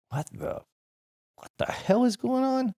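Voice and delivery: puzzled at a midnight banging; half-whispered then baffled; startled. Retold or imitated. Banging on the door at night.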